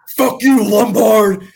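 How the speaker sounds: high, loud barked curse; yelled insult. Retold or imitated. Sergeant yelling at a late soldier, loud angry shout. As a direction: bark the curse at him.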